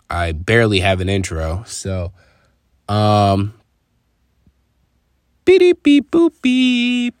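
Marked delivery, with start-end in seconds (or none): 5.5-7.1 high beeping robot sounds; toy robot voice. Real, not acted. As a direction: beep like a little toy robot. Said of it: "A lot of people said they like that".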